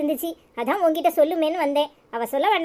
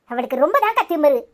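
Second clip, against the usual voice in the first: ordinary talk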